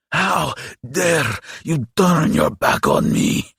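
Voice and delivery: high, slow, indignant monster; warning demon. A narrator's reading. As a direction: warn him off as an affronted demon. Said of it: "the creature warned".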